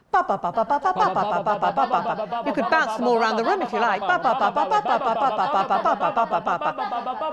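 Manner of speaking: "Ba" popped over and over with a light laugh; bouncing Ba. Shown as a demonstration. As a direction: bounce "ba" off the wall in front. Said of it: "bounce lots of Bs off the wall".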